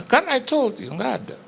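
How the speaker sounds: high-pitched told-you-so; imitated wife. Wife shows husband there is no rice, high imitated protest. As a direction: insist in a high mock-wife voice.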